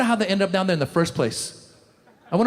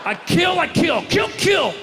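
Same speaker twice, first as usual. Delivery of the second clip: mock threat shout; 9 semitones higher, slower than usual. Chechen uncle threatening the crowd: hammed-up shout. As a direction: bellow the threat over and over.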